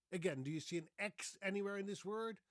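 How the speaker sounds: ordinary talk, nothing marked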